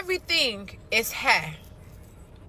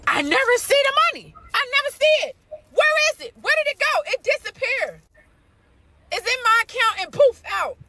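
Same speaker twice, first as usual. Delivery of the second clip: much higher, fast and incredulous; exasperated disbelief; 9 semitones higher than usual. Real, not acted. Woman venting to her viewers about vanishing pay, incredulous. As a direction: demand where the money went.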